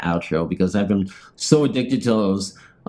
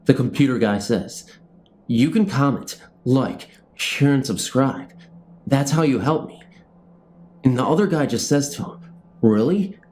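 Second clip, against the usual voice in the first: TV show narration; 4 semitones higher than usual. Acted. Vlogger narrating his made-up show outro, announcer style. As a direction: introduce the episode like a show host.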